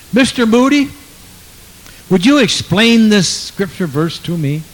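high, loud, earnest questioner; questioning stranger. Retold or imitated. Stranger putting a hard question to the evangelist, high and loud.

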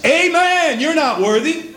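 heaven shouting. Pretend. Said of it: "heaven shouts".

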